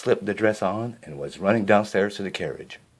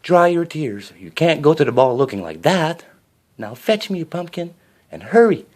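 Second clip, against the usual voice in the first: high, louder bustling godmother; fairy godmother; 7 semitones higher, 4 dB louder than usual. A narrator's reading. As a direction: hurry her along kindly.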